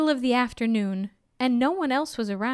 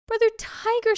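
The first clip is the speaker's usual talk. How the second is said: high, shocked plea to the tiger; startled Brahmin; 11 semitones higher than usual. Acted. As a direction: cry out in disbelief. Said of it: "said the Brahmin".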